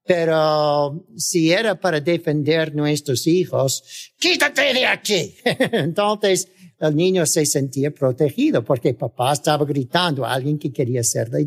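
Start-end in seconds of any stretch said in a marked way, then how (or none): 4.2-5.4 father's shout. Retold or imitated. Dad yells at a threat to protect his children. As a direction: bark it at someone threatening the kids. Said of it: "muy gritón".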